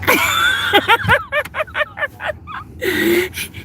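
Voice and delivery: long, high-pitched laugh; cracking up. Real, not acted. Streamer cracking up at a visitor's teasing, laughing high.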